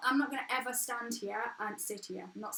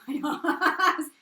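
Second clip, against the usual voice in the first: louder, laughing through the words; real laughing; 5 semitones higher, 10 dB louder than usual. Real, not acted. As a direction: crack up while saying it. Joking mid-sentence, breaks into laughter.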